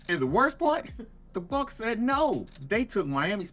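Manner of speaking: high, loud scoff with a laugh; mocking laugh. Real, not acted. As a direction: laugh at their failure. Mocking the Celtics' failed trade.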